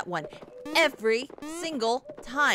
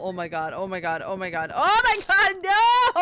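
panicked screaming; 6 semitones lower, 5 dB louder than usual. Real, not acted. Panic as hazard hits.

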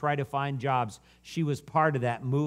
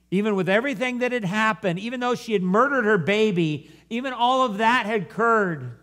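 high, raised preacher's voice; impassioned preaching; 8 semitones higher, 6 dB louder than usual. Real, not acted. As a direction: preach it with rising fervour.

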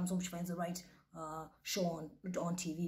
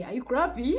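loud, higher outburst; outraged disbelief; 6 semitones higher, 11 dB louder than usual. Real, not acted. Viewer appalled by the man on the show, loud incredulous outburst. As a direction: blurt it out louder in disbelief. Repeats question, outraged at clip.

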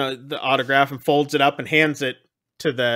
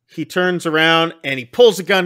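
normal talking voice

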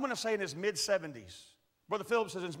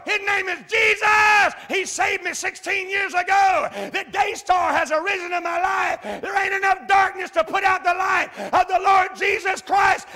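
shouted high and slow, pulpit thunder; full-force preaching; 10 semitones higher, 12 dB louder, slower than usual. Retold or imitated. Preacher to old man in the bar, shouting the gospel loud and high. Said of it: "I turned into preacher".

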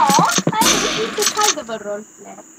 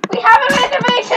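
mock insistence; 8 semitones higher, 7 dB louder than usual. Playing the club host, exclaiming.

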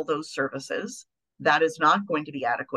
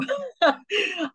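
short laugh. Real, not acted.

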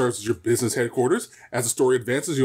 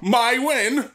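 mocking; 12 semitones higher, 4 dB louder than usual. Mocking sibling's words.

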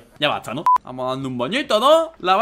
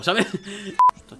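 ordinary talk

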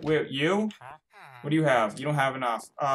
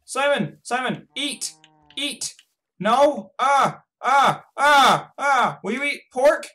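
high, urgent repeated calls; frantic pleading; 11 semitones higher, 6 dB louder, slower than usual. Real, not acted. Player desperate to feed Simon, frantic pleading. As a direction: plead in a high-pitched panic.

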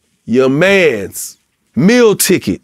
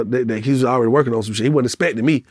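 normal talking voice

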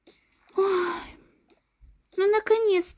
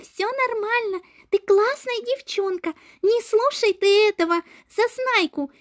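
ordinary talk

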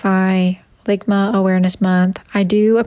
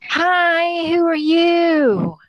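much higher, slow sing-song hi; high slow silly greeting; 8 semitones higher, much slower than usual. Acted. Streamer greeting a strange caller in a high slow voice. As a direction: stretch it out in a high cartoon voice. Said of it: "streamer greets next caller".

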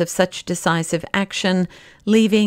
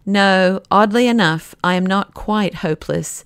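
ordinary delivery

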